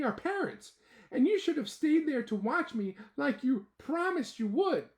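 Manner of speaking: high, loud little ant turned wise; philosopher voice. Acted. Ant boy explaining to Joey what they did wrong, mock wise voice. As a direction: lecture like a tiny philosopher. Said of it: "in his philosopher voice".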